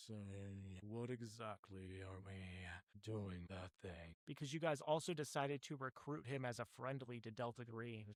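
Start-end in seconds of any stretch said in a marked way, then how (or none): 0.1-4.1 out of breath. Acted. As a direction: play a winded doctor, pitched down and dragging. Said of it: "why are you so out of breath".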